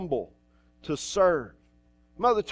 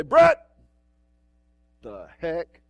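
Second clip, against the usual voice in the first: half-playful exclamation at a colleague; mock exasperated call; 5 dB louder, much slower than usual. Pastor scolds Brett, retold.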